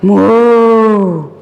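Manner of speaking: a thank-you moo after grass; grateful moo. Acted. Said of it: "She said gratefully".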